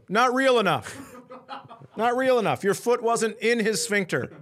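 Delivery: mock a fussy director through laughter. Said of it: "telling a professional fighter to go".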